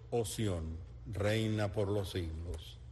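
usual speaking voice

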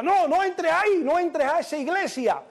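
high-pitched panicked pleading, acted out; frightened warning. Husband re-enacts warning the groom on the video, frightened high cry. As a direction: cry out a mock-desperate warning at the screen. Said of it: "asustado, decía".

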